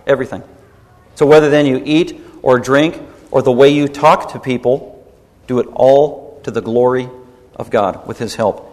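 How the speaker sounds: ordinary talk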